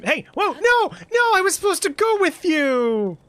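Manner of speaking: high, loud startled yelling; panicked high shout at the screen; alarmed shout. Real, not acted. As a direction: yell out as it slips away. Startled as game goes wrong.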